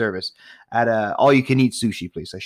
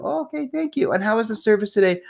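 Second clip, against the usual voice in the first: high-pitched mock server voice; hammed-up customer-service pitch; chirpy server; 11 semitones higher than usual. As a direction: put on a high, polite service voice. Acts out server at billing.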